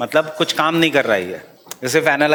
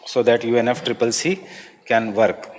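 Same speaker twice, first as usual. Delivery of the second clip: slow note dictation with long pauses; classroom dictation; 2 semitones lower, slower than usual. Real, not acted. Teacher gives students a line for prelims, slow dictation.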